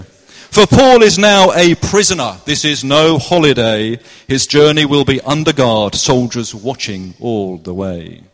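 rhyming narration in a put-on Cornish lilt; best Cornish accent. Shown as a demonstration. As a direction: recite it like a Cornish storyteller. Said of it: "This is my best Cornish accent".

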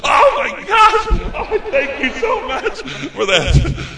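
very high, drawn-out mock squeal; overjoyed shriek. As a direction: imitate a woman gushing with thanks at full pitch. Acts out the lady freaking out.

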